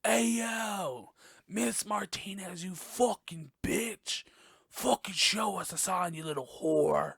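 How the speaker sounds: high, cocky voice goading a ghost; brash gamer daring the spirit to appear; hammed-up provocation in a haunted house; mock ghost taunt. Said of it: "walking in there and be like".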